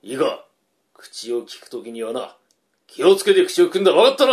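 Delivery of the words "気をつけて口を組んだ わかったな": loud, high, fast scolding; angry shout. Acted. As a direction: bark the order at her. Husband scolds his wife.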